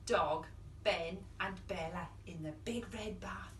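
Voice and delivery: hushed unvoiced whisper of the refrain. Storyteller whispers the refrain to the children. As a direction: breathe the line softly.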